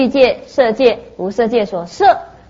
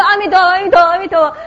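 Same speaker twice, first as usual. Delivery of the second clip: high, louder rapid-fire chanting; imitating eager children; 8 semitones higher, 3 dB louder, faster than usual. Three children reciting for a coin each, eager mock chant. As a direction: rattle it off like kids after a reward.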